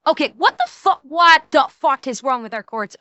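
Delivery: ordinary delivery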